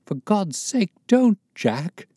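wife pleading desperately in a high voice; woman's pleading cry. A narrator's reading. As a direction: beg him to stop, crying out. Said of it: "she cried".

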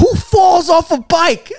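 high, slow scoffing question; a hammed-up pundit's scoff; mocking outrage. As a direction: sneer at it like a pundit. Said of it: "the conservative media was just like".

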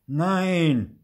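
put-on hard-line kid's no; firm refusal. A narrator's reading. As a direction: refuse forcefully as a bossy child. New boy refuses Theo again.

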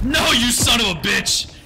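exasperated swearing; real gamer outburst. Real, not acted. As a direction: yell at whoever just got you. Attacked in game.

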